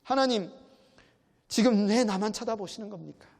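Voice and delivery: a loud, high cry of protest at God; rough outcry. Retold or imitated. Job crying out to God, roughly and loudly. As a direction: hurl the question at God, raw and unpolished. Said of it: "막 거칠게 나오는 거죠".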